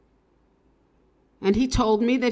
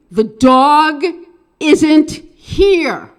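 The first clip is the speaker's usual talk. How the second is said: loud and higher, called out to be heard; coaching the girlfriend to call out the window; stage voice call; 6 semitones higher, 12 dB louder, much slower than usual. Shown as a demonstration. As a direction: project it like on stage. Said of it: "with your stage voice".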